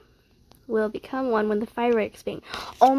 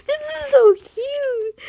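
much higher, loud, slow squeal; cute squeal; 13 semitones higher, 8 dB louder, slower than usual. Real, not acted. Fan squealing at photo.

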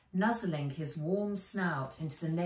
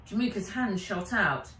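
usual speaking voice

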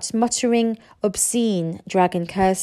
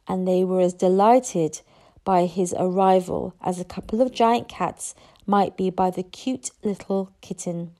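ordinary delivery, nothing marked

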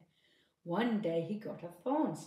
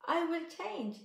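much higher mouse voice; mouse promise; 10 semitones higher, 3 dB louder than usual. Acted. Mouse promising the bride, high-pitched. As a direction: promise earnestly like a small mouse. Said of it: "said the mouse".

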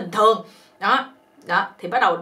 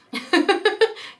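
high amused giggle; 9 semitones higher than usual. Real, not acted. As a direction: giggle at the cheeky title. Giggle at poem title.

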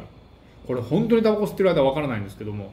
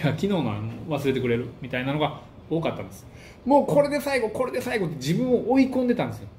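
normal talking voice

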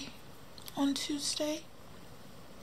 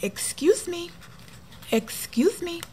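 polite excuse me; 8 semitones higher, 4 dB quieter than usual. Retold or imitated. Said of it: "I said it twice".

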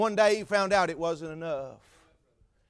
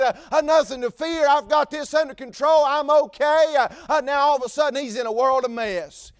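rising sermon cadence, a bit louder; preaching; 5 semitones higher, 5 dB louder than usual. Acted. Preacher tells the church of the jailer, fervent preaching. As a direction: preach it with building fervor.

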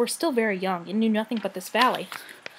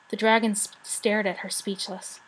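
ordinary delivery, nothing marked

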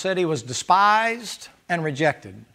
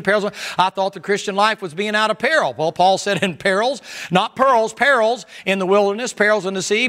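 higher, louder fervent reading; fervent scripture preaching; 4 semitones higher, 3 dB louder than usual. Acted. Preacher reading Paul's sufferings to the church, fervent and loud. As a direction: preach the verses with rising fire.

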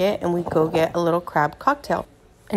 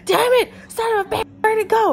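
much higher, strained put-on voice for dad; imitating dad cursing. Dad hunting the dropped ember, cursing in a high put-on voice. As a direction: squeak out the curses while searching.

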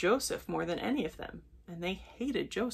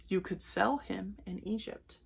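ordinary delivery, nothing marked

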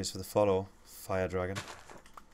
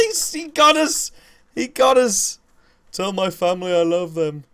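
wounded soldier gasping; 11 semitones higher, 8 dB louder, slower than usual. Pretend. Player character dies in game.

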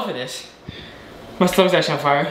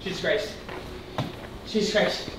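pained mutter; 11 dB quieter than usual. Real, not acted. Burning mouth after the challenge.

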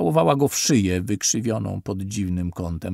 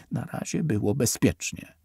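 ordinary delivery, nothing marked

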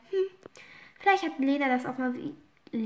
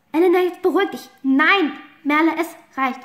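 loud call; 2 semitones higher, 5 dB louder than usual. Acted. Quarrel, calling others to calm.

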